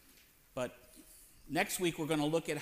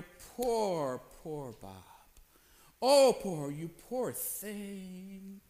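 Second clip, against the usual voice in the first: mock pity; much slower than usual. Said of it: "I wanna hear".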